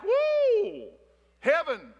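high, loud whoop of joy; squealing excited woo. Acted. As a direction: cheer it out like a thrilled kid. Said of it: "[name] got excited".

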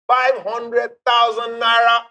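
slow, stretched hype on the big number; milk the prize like a game show host; drawn-out prize announcement. Acted. Host reminding of grand prize.

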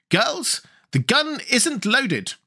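high, drawn-out call to troops; crying out. A narrator's reading. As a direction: cry it to the whole army, slowly and high. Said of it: "turned to her army and cried".

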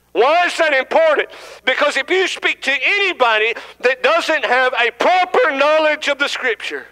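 lifted, rising preaching voice. Acted. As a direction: preach it out to the congregation. Preaching with raised voice.

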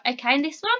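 ordinary talk, nothing marked

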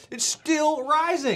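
drawn-out high squeal; high stretched rising. Real, not acted. Heat peaking.

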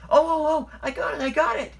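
very high, loud eureka; excited exclamation. A narrator's reading. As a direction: burst out with a sudden idea. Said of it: "he exclaimed".

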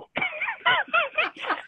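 high, breathy laugh at the absurdity. Real, not acted. Laughs at the mix-up.

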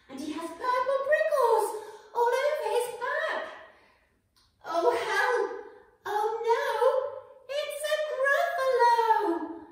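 high, loud, fast rising panic; mock terror. A narrator's reading. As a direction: cry out as if the monster were real. Climax of the monster description.

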